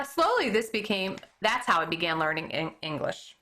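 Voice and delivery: ordinary delivery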